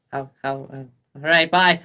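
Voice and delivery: high gnome voice, rising to a loud goodbye; hesitate, then pipe out a farewell. Acted. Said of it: "the gnome looks at you and goes".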